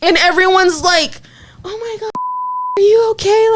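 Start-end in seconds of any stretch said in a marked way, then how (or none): 1.7-3.5 fussing bystanders. Retold or imitated. Everyone checking on the crying girl, high fussy concern. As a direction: imitate a cooing, worried crowd, pitched way up.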